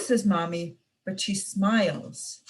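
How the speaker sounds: plain reading voice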